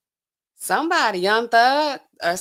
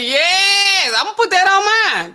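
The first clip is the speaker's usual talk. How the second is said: excited; 13 semitones higher, 6 dB louder than usual. Real, not acted. Excited about making a video.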